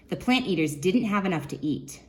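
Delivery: ordinary talk, nothing marked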